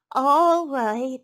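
high, loud, drawn-out resignation; sighing piglet. A narrator's reading. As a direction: give in as a reluctant piglet. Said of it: "sighed the piglet".

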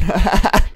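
high, loud gleeful laugh; delighted laugh. Real, not acted. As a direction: burst out laughing with glee. Laughing at his advantage.

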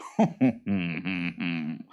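pleased monster chuckle; boggart chuckle. A narrator's reading. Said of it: "chuckled the boggart".

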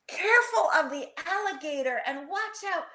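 high, frantic storybook warning; yelled warning. A narrator's reading. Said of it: "yelled Rocky".

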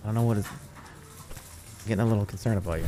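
put-on low Boston-accented nagging; concerned Boston guy. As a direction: lecture a buddy as the Boston guy.

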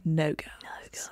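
hushed, whispered reply; mock ominous whisper. He answers about the prison guards, hushed mock-ominous whisper. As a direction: drop to an eerie whisper.

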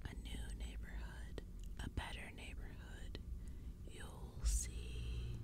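soft, long sibilant hiss; hissing whisper. A narrator's reading. Second pumpkinhead agreeing with its partner, hissing. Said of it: "his partner hissed".

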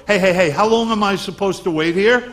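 pushy New York complainer; New York accent, hammed-up; New Yorker. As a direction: demand it like an impatient New Yorker. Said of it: "become a New Yorker".

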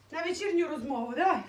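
normal talking voice

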